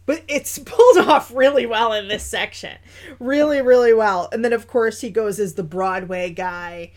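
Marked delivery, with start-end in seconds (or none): none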